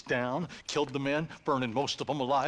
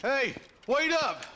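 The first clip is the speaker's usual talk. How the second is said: loud high call to catch up; 13 semitones higher, 4 dB louder than usual. Acted. Rider shouting to the posse to wait up. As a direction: shout after them to wait.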